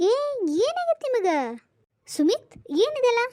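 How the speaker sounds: high, indignant disbelief; shocked outburst. Acted. Woman to two coworkers proposing, shocked disbelief. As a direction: throw it at them, stunned and offended.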